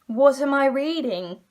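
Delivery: slow, drawn-out baffled disbelief; mock bewildered reader. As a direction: stretch it out like you can't believe the page. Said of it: "and I was like".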